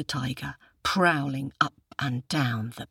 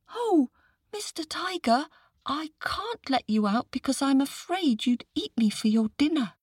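put-on very high child voice, wary; timid little boy; 11 semitones higher than usual. A narrator's reading. Boy refuses to free the tiger, high-pitched and wary. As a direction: squeak it out as a small boy.

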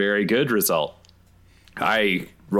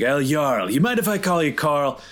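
higher-pitched, hammed-up needling insults; mocking taunt; 4 semitones higher than usual. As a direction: goad him with playful, raised-pitch mockery. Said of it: "my ability called Sticks and Stones".

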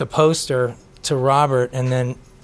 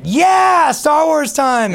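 high, loud geeky cheer; excited shout; 13 semitones higher, 5 dB louder, much slower than usual. Retold or imitated. Dad rallying his kids for Star Wars, excited shout. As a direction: holler it like an overexcited dad, drawn out at the end.

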